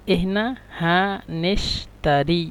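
slow, syllable by syllable: এহনা হানেশতারি; slow teaching repeat. Shown as a demonstration. Teacher repeating the Egyptian phrase to students, slow and deliberate. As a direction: draw out এহনা হানেশতারি slowly for learners to copy.

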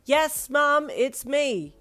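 loud, high call to mum. Acted. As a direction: shout it through the door. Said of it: "you called".

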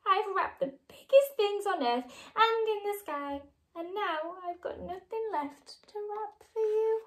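lightly acted crying lament; tearful. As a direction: still high and mock-tearful. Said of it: "sobbed Scarlett".

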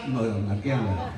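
ordinary talk, nothing marked

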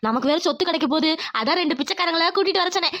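ordinary talk, nothing marked

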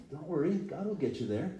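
ordinary talk

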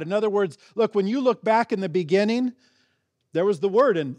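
ordinary delivery

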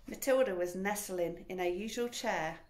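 ordinary delivery

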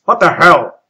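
loud, exasperated captain. Acted. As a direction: demand it in disbelief. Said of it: "he asked".

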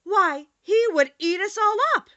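high, loud, frightened protest; alarmed cry. A narrator's reading. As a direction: exclaim in alarm, voice shooting up. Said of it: "cried the little queen".

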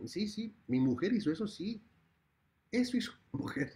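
high disbelieving echo; stunned husband voice. Acted. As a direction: repeat it as if you can't believe it. King repeating what wife did.

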